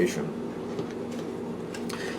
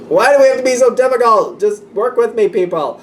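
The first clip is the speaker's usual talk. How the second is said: high, strained complaint, hands up; mock frustrated plea, higher pitch; high exasperated whine; 9 semitones higher than usual. Reader voicing a frustrated person, high whiny complaint. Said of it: "kind of like".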